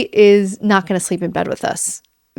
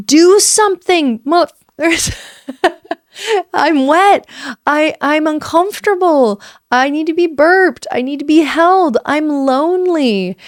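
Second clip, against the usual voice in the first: voicing the crying baby; 6 semitones higher than usual. Mother voicing what her crying baby is asking for, lightly pleading. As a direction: plead like a needy little baby, lightly voiced. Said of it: "the communication is".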